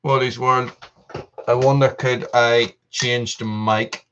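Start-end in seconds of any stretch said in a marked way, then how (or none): none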